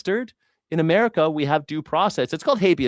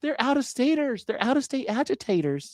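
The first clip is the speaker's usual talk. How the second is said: sarcastic parroting; 9 semitones higher, 3 dB quieter than usual. Pretend.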